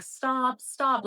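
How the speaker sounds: high, urgent alarm of a nervous system; mock panicked cry; acted-out panic. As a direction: play an inner voice begging to halt. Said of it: "my nervous system was freaking out".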